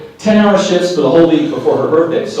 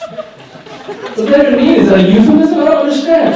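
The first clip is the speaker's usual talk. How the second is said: baffled squeal; 12 semitones higher, 8 dB louder than usual. Retold or imitated. Husband squealing confused at wife's strange compliment. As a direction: shriek your confusion laughing.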